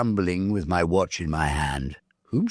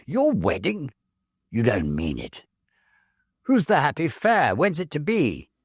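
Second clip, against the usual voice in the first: high, bright mock astonishment, raised voice; surprised teasing; 7 semitones higher than usual. Club friends teasing John about his wedding, surprised and mocking. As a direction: toss out the questions in amused disbelief.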